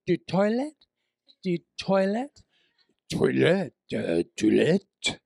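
a hint of mock French accent, rising. Lost tourist asking French riders for a toilet, in mock French. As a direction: fumble a fake French accent. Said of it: "And I'm going".

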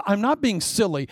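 usual speaking voice

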